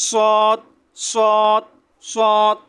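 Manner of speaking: "সৎ" with a thick, heavy s, held level; som grosso. Shown as a demonstration. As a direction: make "সৎ" full and heavy. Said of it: "মোটা করে উচ্চারণ করবেন".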